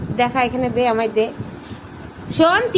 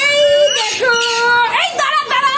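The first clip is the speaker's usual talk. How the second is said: excited reveal; 7 semitones higher, 6 dB louder than usual. Real, not acted. Showing the child the surprise.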